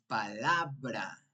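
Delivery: "palabra" stressed pa-LA-bra, LA lengthened; stress on middle syllable. Shown as a demonstration. Teacher correcting the stress, "palabra" leaning on LA. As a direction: lean firmly on LA in "palabra". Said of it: "la sílaba tónica es la penúltima sílaba".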